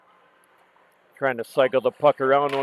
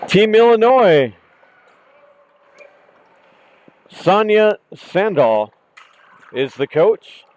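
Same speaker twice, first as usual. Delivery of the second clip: announcer voice; 5 semitones higher, 8 dB louder than usual. Real, not acted. Announcing the team and coach.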